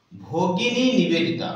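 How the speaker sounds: Bhagini Nivedita said slowly, stress on Bhagini; slow memory phrase. Shown as a demonstration. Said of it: "আপনাদের সূত্র দিচ্ছি". (ধীরে ধীরে ভগিনী নিবেদিতা)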